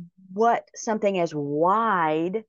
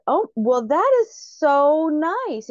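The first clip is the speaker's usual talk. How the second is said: high, loud, drawn-out acted politeness; gracious surprise; 7 semitones higher, 5 dB louder, slower than usual. Speaker replying to the gushing stranger, acted gracious surprise. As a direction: play up a flattered, bewildered thank-you.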